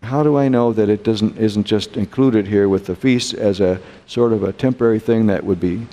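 ordinary delivery

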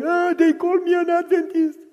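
put-on high, whimpering little boy; boy complaining at home, high weepy voice; crying child. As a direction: whine it like a hurt first grader.

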